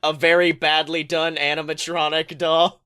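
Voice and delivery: usual speaking voice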